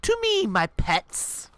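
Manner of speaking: very high, slow summoning call; villain summons. Acted. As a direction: call your pets with grand menace.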